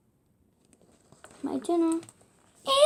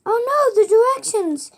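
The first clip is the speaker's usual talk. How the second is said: higher and louder, panicked; alarmed cry; 7 semitones higher, 7 dB louder than usual. Acted. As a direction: blurt it out as if the directions are lost. Duck driver panics over directions.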